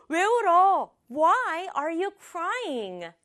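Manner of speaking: high, alarmed 왜 울어 then slow Why are you crying; a boy caught off guard by tears; 놀란 오빠 목소리; startled big brother. Acted. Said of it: "지우가 막 놀라가지고".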